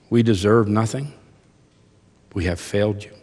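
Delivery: earnest, measured prayer voice; closing prayer. Real, not acted. Pastor leading the congregation in prayer, humble and earnest. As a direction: pray humbly for the church.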